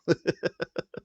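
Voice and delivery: high giggle; laugh. Real, not acted. Host laughing after their own outburst, light giggle. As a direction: break into a giggle.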